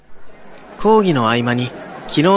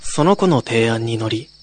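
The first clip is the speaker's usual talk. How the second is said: normal talking voice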